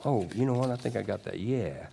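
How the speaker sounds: normal talking voice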